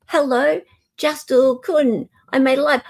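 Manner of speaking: normal talking voice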